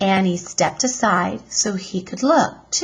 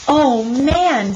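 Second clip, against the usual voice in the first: awed; 6 semitones higher, 5 dB louder than usual. Acted. Jack awed at moon flag.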